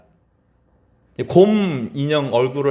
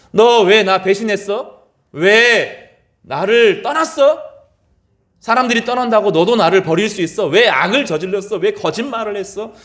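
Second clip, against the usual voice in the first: imagined accusation; 7 semitones higher, 5 dB louder than usual. Pretend. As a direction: press each question like a hurt accuser. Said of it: "이렇게 말씀하시지 않았습니다".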